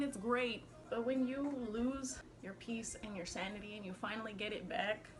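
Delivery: normal talking voice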